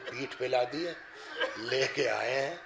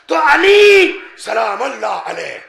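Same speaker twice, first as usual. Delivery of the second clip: much louder and slow, drawn-out invocation; fervent exclamation; 8 semitones higher, 16 dB louder, slower than usual. Acted. Preacher invokes Ali before the gathering, loud and slow. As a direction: call out the name with devotion.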